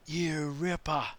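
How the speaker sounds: high, slow, delighted American "you ripper"; a tourist trying out Aussie slang; high, drawn-out delighted cheer; tourist's slang. Retold or imitated.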